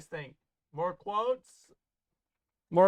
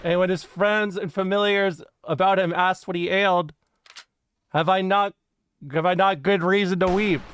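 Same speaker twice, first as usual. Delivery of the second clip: dramatic quote reading; 4 dB louder than usual. Acted. Streamer reads an Alexander the Great anecdote to chat, solemn storytelling. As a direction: read it like a grand historical tale.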